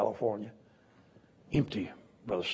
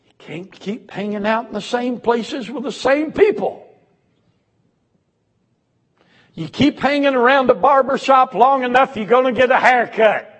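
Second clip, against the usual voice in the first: loud, high preaching call; preaching hard; 13 semitones higher, 11 dB louder than usual. Acted. Preacher hammering the congregation about old haunts, loud. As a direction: drive the point home to the congregation.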